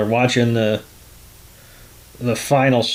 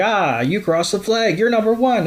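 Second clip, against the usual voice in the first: mock cheering; 8 semitones higher than usual. Meowth declares Choodle the winner, mock cheer. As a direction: climb in pitch toward the proclamation.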